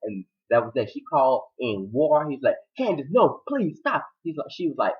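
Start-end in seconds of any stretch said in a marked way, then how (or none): 2.8-4.1 higher-pitched mock plea; pleading. He begs Candace to stop, pleading. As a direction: beg them to stop, acted.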